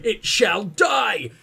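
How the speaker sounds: slow booming villain declaration; villain shout. Pretend. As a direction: proclaim it like a supervillain. Mock threat against the sun.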